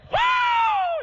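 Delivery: long, very high, loud "whoo"; full-out jubilant whoop, falling at the end; shouted whoop. Retold or imitated. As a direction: let loose a soaring holler. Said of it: "We shouted".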